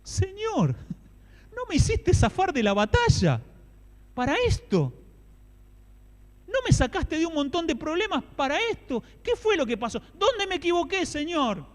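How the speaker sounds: high, loud pleading prayer; anguished David. Acted. David crying out to the Lord, high anguished plea. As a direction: cry out to God in anguish. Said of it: "Saben cómo imagino a David".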